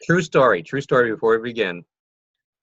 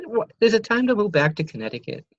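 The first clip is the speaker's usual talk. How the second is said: mom's voice, pitched much higher; lightly voiced mom, teasing; imitating his mom; 11 semitones higher than usual. Host quoting his mom about Connecticut, mock high mom voice. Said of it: "you sound like my mom".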